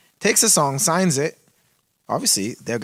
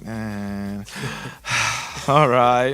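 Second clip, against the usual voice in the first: mock sob-story; 4 semitones lower, much slower than usual. Rapper giving the producer a sob look, sighing. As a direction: sigh it out like you've been wronged. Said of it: "giving all the sob look".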